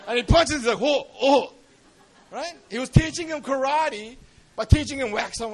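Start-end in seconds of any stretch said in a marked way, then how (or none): 0.8-1.5 loud, higher acted cries of wonder; hammed-up astonishment; amazed exclamation. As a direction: exclaim with each punch. Student punching in amazement.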